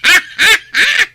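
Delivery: bright burst of giggling; short giggle over village gossip. Acted. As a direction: laugh it off, tickled by the gossip.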